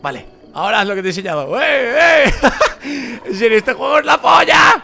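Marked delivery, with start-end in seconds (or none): 1.6-2.4 loud, high "eh!" of delight; excited shouting. Real, not acted. Player cheering on the game, excited shouting. 3.3-4.8 loud, high shout of delight; excited shout. Real, not acted. Gamer raving about the game, excited shouting.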